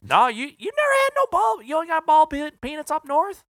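mock the rep's Southern twang, way up high; southern drawl. Sales rep teasing a northerner in exaggerated Southern drawl. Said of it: "He goes".

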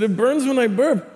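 put-on high, insistent kid voice; imitated child's complaint. Son repeats complaint to mom, high mock insistence. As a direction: repeat it as an upset little boy. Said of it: "I said".

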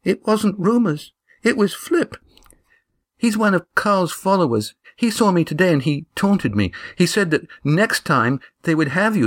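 put-on high, earnest girl's voice; young Eva. A narrator's reading. Eva warning the friends, high worried voice.

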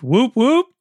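questioning little whooping call; literal whoop. Shown as a demonstration. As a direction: sound it out like a real cheer. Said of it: "literally, like".

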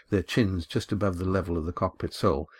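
ordinary delivery, nothing marked

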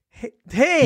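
showman calling out to the crowd; carnival barker. Acted. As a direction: bark it like a fairground host. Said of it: "You sound like a carnival barker".

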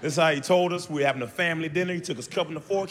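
ordinary talk, nothing marked

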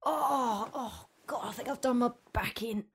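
hushed groaning whisper; pained whisper. Real, not acted. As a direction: whisper through a sore back. Aching back after performance.

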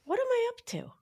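high playful cooing as to a kitten; curious cooing. Shown as a demonstration. Coaxing her inner child, high cooing. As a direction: coo it like talking to a pet.

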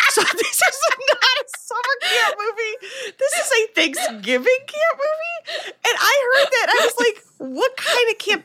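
high-pitched, helpless laughing protest; cracking up in disbelief, voice shooting way up; laughing incredulously. Real, not acted. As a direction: squeal it through real laughter. Cracking up over the Thanksgiving setting.

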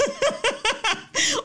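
high-pitched laughing fit; burst of laughter. Real, not acted. Host amused by a listener's letter, high laugh. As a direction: crack up mid-sentence.

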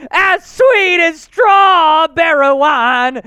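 high, loud, drawn-out announcer shout; full-force celebratory hollering; hype announcer. Acted. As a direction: bellow it like a ring announcer, stretching every phrase.

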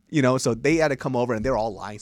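ordinary talk, nothing marked

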